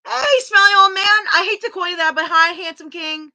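high, loud, playful hello to a viewer; excited greeting. Real, not acted.

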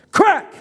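high, punchy sound-effect crack; sharp crack cry. A narrator's reading. Narrator voices belt striking hands, high sharp crack. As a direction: snap the word out like a whip.

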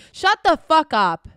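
loud, slow, hard "Shut the fuck up"; yelling at a self-pitying viewer to stop, loud; loud snap. Real, not acted. Snapping at viewer.

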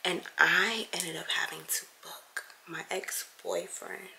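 hushed stressed whisper. Real, not acted. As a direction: keep it down, barely holding it together. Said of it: "I'm trying not to lose my cool".

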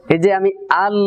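ordinary delivery

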